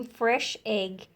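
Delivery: plain reading voice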